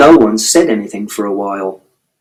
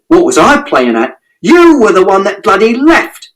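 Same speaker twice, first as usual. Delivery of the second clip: rising to a high yell on the second line; bitter shout; 8 semitones higher than usual. A narrator's reading. Father shouting at mother for leaving. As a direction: turn the blame back on her. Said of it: "And Father shouted".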